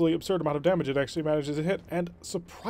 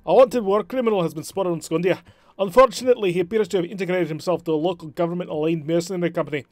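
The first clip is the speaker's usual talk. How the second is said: put-on Scottish accent; 2 semitones higher, 6 dB louder than usual. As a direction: read the briefing in a shaky Scottish accent. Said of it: "my Scottish accent went all over the place".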